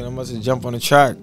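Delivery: ordinary talk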